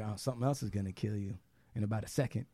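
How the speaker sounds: quiet threat. Retold or imitated. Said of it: "She'll be like".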